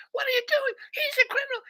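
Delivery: very high shrieking mock mom; outraged mother. Beaten guy's mother to the police, mock outraged shriek. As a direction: protest in a piercing high mother's voice. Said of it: "mom of that beat up guy's".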